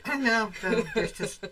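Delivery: amused words riding on a laugh. Real, not acted. Laughing at their shared joke.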